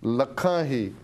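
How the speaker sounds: slow, measured verse with swelling, falling line ends; stirring, drawn-out poetry reading; poem recitation. Real, not acted. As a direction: declaim each line deliberately, pausing between phrases. Recites a listener's poem aloud.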